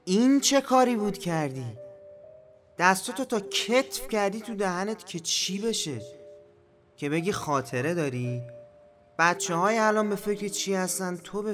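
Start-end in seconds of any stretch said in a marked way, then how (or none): 0.1-6.0 scolding. Acted. Scolding a disgusting mouth sound.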